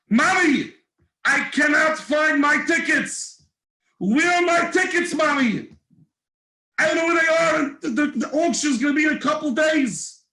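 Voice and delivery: put-on high, loud panicked little boy; a kid in a panic over lost tickets; frantic child. Young boy to his mother, frantic and high.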